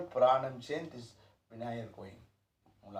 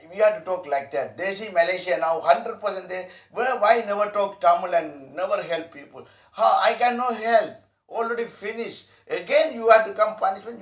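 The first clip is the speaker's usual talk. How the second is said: louder, higher preaching voice; fervent preaching; 4 semitones higher, 8 dB louder than usual. Acted. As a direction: urge them like a preacher. Teacher preaching with force.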